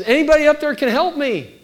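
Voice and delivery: high, loud desperate call; calling up for help. Retold or imitated. Backpacker clinging to the bush calls up to the sky, loud desperate plea. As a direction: call up the mountain, pleading.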